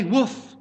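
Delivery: playful woof from a preacher's joke; dog woof. Acted. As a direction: bark it like a friendly dog. Dog answers butcher in joke.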